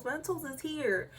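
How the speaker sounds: ordinary talk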